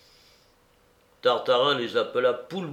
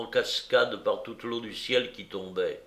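ordinary delivery

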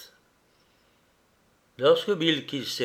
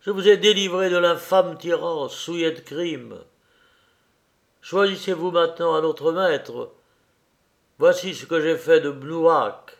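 raised, sonorous queen addressing a crowd; loud, ringing voice; 5 semitones higher, 4 dB louder than usual. A narrator's reading. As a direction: declare it higher and firmly to the assembled people. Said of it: "parla d'une voix haute et sonore".

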